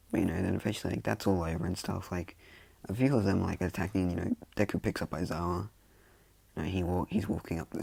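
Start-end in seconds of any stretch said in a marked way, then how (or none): none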